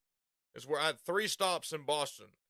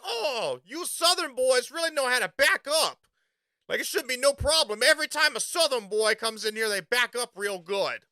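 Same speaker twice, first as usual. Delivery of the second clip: higher and louder, a ribbing Northern dock hand; playful Yankee ribbing, pitched up; teasing Yankee worker; 6 semitones higher, 6 dB louder than usual. Retold or imitated. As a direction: josh him about Southern boys backing up. Quoting Yankee worker teasing Southerners.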